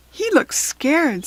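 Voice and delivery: worried fairy noticing; lightly voiced fairy. A narrator's reading. Savannah seeing the baby zebra, concerned.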